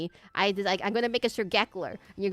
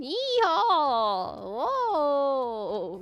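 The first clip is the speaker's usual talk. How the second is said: high, drawn-out mock Western whoop; hammed-up Western yeehaw; bad Western accent; 4 semitones higher, much slower than usual. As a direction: holler like a movie cowboy, badly faked Western. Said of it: "I can't do a Western accent".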